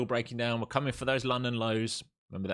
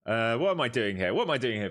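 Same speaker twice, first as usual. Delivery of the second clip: usual speaking voice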